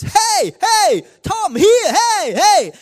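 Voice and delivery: high, piercing attention-grabbing shouts; loud distraction. Acted. Noisy thoughts calling Tom as they sit to pray, shouted high. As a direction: yell to pull someone's attention away. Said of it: "ist das plötzlich laut".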